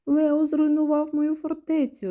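high, plaintive mock wail; sobbing, acted. A narrator's reading. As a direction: wail lightly like an upset child in a story. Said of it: "ридає Діді". (жалібно, ніби ридаючи)

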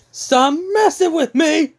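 high, loud acted yell; furious high-pitched shout in character; angry outburst. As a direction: yell it in a fit of anger. Acted angry outburst in retold fight.

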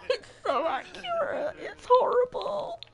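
high, squeaky laugh-crying through the words. Real, not acted. Player cracking up at her sad sim, high laugh-crying. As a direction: laugh so hard it nearly turns to tears.

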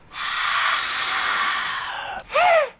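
long, high startled gasp; drawn-out acted gasp; frightened gasp. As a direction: suck in air in fright. Hears a scary sound.

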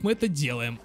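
ordinary talk, nothing marked

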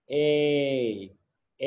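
"A" drawn out as "ay"; long A. Shown as a demonstration. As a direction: hold each "A" long, as a model to copy. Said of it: "ยาวด้วย". (ลากเสียง "A" ให้ยาว)

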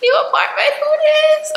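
high squeaky hello voice; chirpy, up high, playful; squeaky greeting. Retold or imitated. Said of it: "I was just like".

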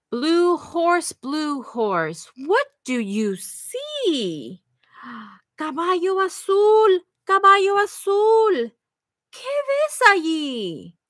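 bright, lilting read-aloud for little ones; sing-song the refrain to the kids; reading to children. Acted. Librarian reading the refrain to children, sing-song.

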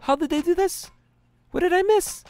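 high, loud alarmed cries; shocked surprise. Real, not acted. Gamer finding his base nearly destroyed, shocked and loud. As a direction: gasp it out in disbelief.

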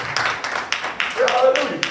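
normal talking voice

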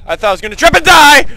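fast, loud, high cry of alarm; alarmed outburst. Real, not acted. Player after a near fall in the game, alarmed shout. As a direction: rush it out, rising to a shout.

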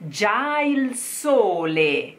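Già il sole read slowly, every vowel pure; slow model line for the class to copy; slow diction demo. Shown as a demonstration. Coach modelling the first phrase for students, slow and clear. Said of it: "You try".

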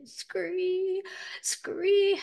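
high drawn-out "scree"; acted high animal cry; rabbit screech.

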